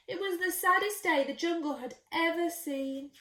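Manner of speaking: ordinary delivery, nothing marked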